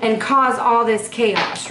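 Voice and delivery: ordinary talk, nothing marked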